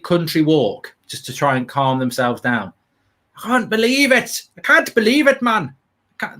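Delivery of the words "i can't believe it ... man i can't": high mock Geordie lament; Geordie accent. Mock Geordie fan moaning about England's win. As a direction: wail it like a gutted Geordie. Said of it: "that's Geordie".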